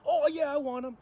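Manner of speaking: high, loud and greedy; eager grab. Retold or imitated. Greedy cellmate loudly claiming the extra eggs, eager. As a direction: jump at the offer like a glutton.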